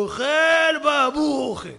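put-on loud barking shout from the old commander; gruff commander yelling; officer's shout. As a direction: shout it across the room like an officer.

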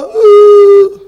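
high, loud mock wail; loud wailing cry. Persian passenger wailing at the bus driver, hammed-up loud wail. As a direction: let out a hammed-up sobbing wail. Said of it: "למה אתה בוכה".

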